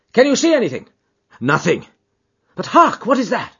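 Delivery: high, loud, anxious exchange; urgent alarm. A narrator's reading. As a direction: call out urgently while searching. Urgent searching on the moor.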